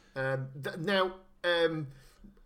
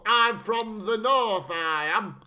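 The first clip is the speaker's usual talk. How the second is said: slow, louder mock Northern English accent; generic Northern accent; 5 dB louder, much slower than usual. Podcaster mocking the Countess's generic Northern English accent, slow. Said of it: "It's just generic North".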